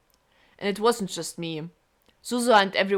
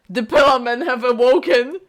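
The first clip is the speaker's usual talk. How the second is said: dramatic; 4 semitones higher, 7 dB louder than usual. Pretend. Dramatic meme joke.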